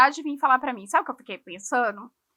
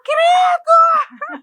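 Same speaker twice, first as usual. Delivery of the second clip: very high, loud mock shriek; hammed-up cry of revulsion; disgusted shout; 14 dB louder than usual. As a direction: imitate a child shouting in horror. Daughter's disgust acted out.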